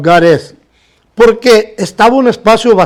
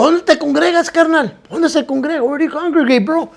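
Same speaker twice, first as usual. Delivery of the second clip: eager asking; 7 semitones higher, 5 dB quieter than usual. Retold or imitated. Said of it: "Y le digo".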